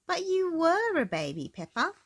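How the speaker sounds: fond mummy correcting her daughter; high Mummy Pig voice. A narrator's reading. Mummy Pig tells Peppa she was a baby.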